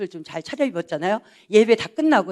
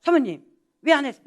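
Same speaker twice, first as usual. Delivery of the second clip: team leader's tone; 5 semitones higher, faster than usual. Retold or imitated. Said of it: "똑같이 얘기하는 거 또".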